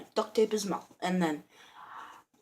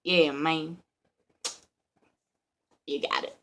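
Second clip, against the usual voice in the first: lightly voiced, lower kid reply; imitated kid voice; 3 semitones lower than usual. Tied-up kid agreeing with the burglar, lightly imitated. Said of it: "wait, that wasn't his voice".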